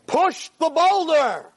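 high, slow, drawn-out command; voice of Hashem. Acted. Hashem orders Yankel the farmer, booming drawn-out command. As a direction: call it out from the heavens. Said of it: "he hears a voice".

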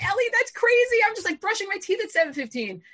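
high, loud objection to brother; incredulous protest. Retold or imitated. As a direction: protest in shrieky disbelief. Protests the early start.

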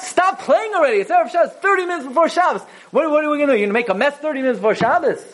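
stressed parent yelling at children to stop playing; harsh scolding. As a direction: snap at the kids in a rush before Shabbos. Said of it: "his mother never spoke like that".

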